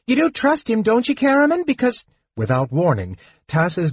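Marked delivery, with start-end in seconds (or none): 0.1-2.0 high, urgent raised voice. Acted. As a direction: call out, forgetting caution. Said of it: "he raised his voice".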